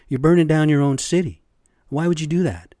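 loud, exasperated yell at the rioters; angry shout. Real, not acted. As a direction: blast it out, fed up.

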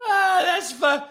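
high voice breaking up with laughter; helplessly amused, high-pitched; laughing. Real, not acted. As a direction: squeeze the words out through laughter. Still cracking up at example.

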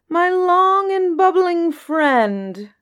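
high, slow, grand genie voice. Acted. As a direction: draw it out like a mocking genie. Djinn addresses the camel.

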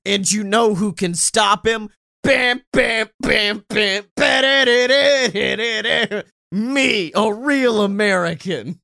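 loud hype wrestler promo voice; wrestling promo. Retold or imitated. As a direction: cut a promo like a pro wrestler. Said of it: "He's cutting a promo".

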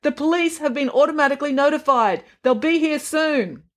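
high, insistent teller voice; calling out. A narrator's reading. Teller behind bandit screen to manager, calling out. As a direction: call out the procedure, pitched high. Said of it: "Her voice was muffled by the bandit screen".

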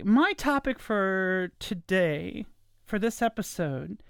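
normal talking voice

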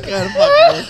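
laughing. Real, not acted. Still high, laughing.

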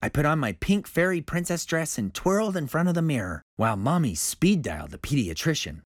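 ordinary delivery, nothing marked

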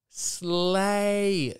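long drawn-out, high mock 'slay'; mocking slang. Mocks the girls' slang word.

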